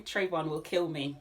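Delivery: ordinary talk, nothing marked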